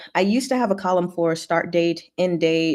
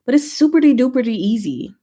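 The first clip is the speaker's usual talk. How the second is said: higher and louder, bouncy and silly; playful singsong; 5 semitones higher, 5 dB louder than usual. Real, not acted. Streamer promising chat it's easy, playful singsong.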